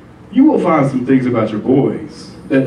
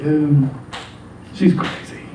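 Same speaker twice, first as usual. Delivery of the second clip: under his breath; 5 dB quieter, slower than usual. Retold or imitated. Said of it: "talking shit under his breath".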